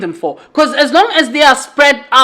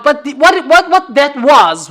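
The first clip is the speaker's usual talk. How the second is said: ordinary talk, nothing marked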